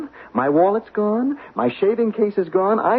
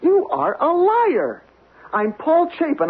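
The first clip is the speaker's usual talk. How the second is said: indignant outburst; 8 semitones higher than usual. Acted. Guest accused of being imposter.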